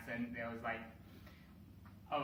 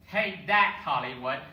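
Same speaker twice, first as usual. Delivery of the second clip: defiant shout; 11 dB louder than usual. Real, not acted. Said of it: "defiant shout at Hollywood".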